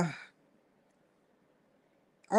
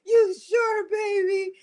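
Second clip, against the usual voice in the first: high, doubtful wifely voice; wife's voice; 12 semitones higher than usual. Retold or imitated. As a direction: ask gently like a doubtful wife. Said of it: "His wife like".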